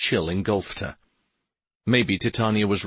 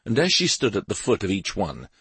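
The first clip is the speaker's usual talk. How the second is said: usual speaking voice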